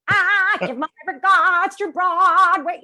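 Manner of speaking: high, loud, slow American singing twang; crow-like twang. Shown as a demonstration. Coach teaching twang, loud American Broadway twang. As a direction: belt it with a piercing Broadway twang. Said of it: "when I'm teaching twang".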